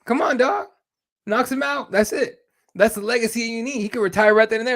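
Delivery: hyped up. Real, not acted. Hyped podcaster talking up a knockout, excited. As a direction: sell it like the dream ending.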